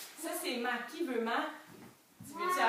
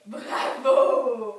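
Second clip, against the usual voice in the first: bravo lancé très fort, qui monte; féliciter la classe avec éclat; bravo enthousiaste; 12 dB louder than usual. Real, not acted. Félicite les enfants. (bravo crié avec enthousiasme)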